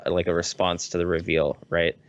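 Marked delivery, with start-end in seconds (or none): none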